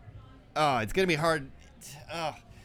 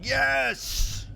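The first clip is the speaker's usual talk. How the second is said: excited yes; 12 semitones higher than usual. Retold or imitated. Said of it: "Scott is somewhere saying".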